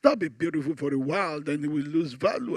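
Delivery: soft, low, intimate sweet-talk; tender husband. Shown as a demonstration. Husband presenting an anniversary gift to his wife, tender. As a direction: coax her gently, like a romantic husband. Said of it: "Call and say".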